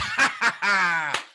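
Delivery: loud mocking cackle at the plan; sly villain's laugh; gleeful scheming laugh. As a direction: laugh like a plotter pleased with his trick. Schemer laughs at own trick.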